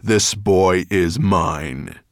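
slow, loud and deliberate; slow menacing claim. Acted. Dragon claiming the boy before the men, slow and loud. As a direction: draw out each word to claim him. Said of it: "His low voice echoed".

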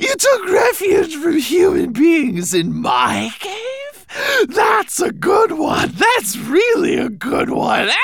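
mocking monster. Monster mocks the hiding dragon.

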